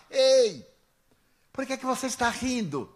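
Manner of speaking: high, loud challenge called across; imitated shout. Man in third line challenges laughing Divaldo, imitated shout. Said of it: "gritou de lá".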